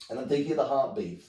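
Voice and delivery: ordinary talk, nothing marked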